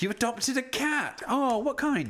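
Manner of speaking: high, slow gushing; high, gushing interest in the cat; delighted cat news. Real, not acted. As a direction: coo over the new cat. Excited about viewer's cat.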